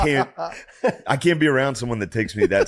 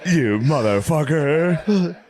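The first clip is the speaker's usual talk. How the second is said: mock sports dad; 7 semitones higher, slower than usual. Pushy parent bragging about his son, hammed-up yell.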